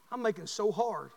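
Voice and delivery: ordinary talk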